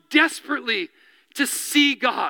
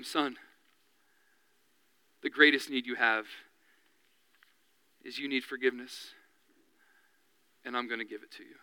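soft, gentle and much quieter; tender Jesus; 11 semitones lower, 10 dB quieter than usual. Retold or imitated. Jesus to the paralytic, soft and tender. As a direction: speak it softly, like a father to his son.

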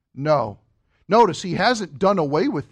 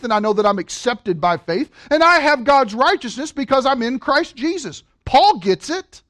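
ordinary delivery

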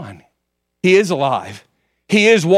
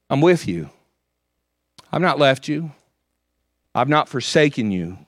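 tender reassurance; 4 dB quieter than usual. Pretend. God answering, soft and low.

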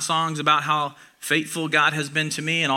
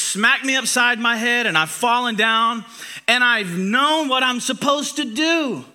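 louder, higher, slow rising preaching; building passion; 7 semitones higher, 5 dB louder than usual. Real, not acted. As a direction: build it up with conviction. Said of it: "repeated, building".

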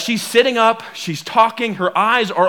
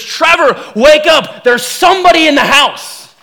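imitated alarm, higher and louder; urgent waking call; 5 semitones higher, 4 dB louder than usual. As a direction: play the wife rousing her sleeping husband. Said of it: "she's shaking me awake".

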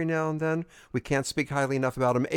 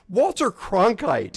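awed emphasis; 6 semitones higher, 6 dB louder, much slower than usual. Real, not acted. Excitedly naming star guest.

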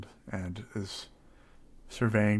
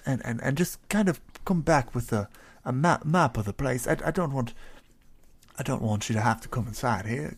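courteous, slightly higher character voice; polite adventurer; 6 semitones higher, 5 dB louder than usual. Acted. Adventurer asking his eagle to scout the town, polite and fussy. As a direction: ask the bird politely, a little fussy.

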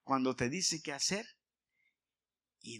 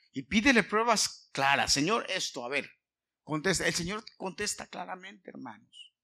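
fervent preaching; 5 semitones higher, 7 dB louder than usual. Acted.

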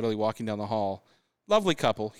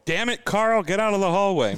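mock clerk; 8 semitones higher, 5 dB louder than usual. Voicing the desk clerk.

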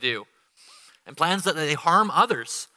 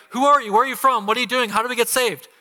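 higher, louder rapid-fire eager questions; eager sailors; 6 semitones higher, 3 dB louder, faster than usual. Acted. Pagan sailors eagerly asking Jonah about his God, excited. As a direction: pepper him with questions, hungry for the answer.

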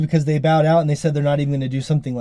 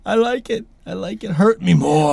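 hammed-up eager begging, pitched up; mock masochist pleading; 5 semitones higher than usual. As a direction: play along as a willing victim. Jokingly acts the eager punching victim.

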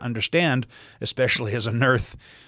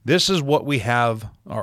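normal talking voice

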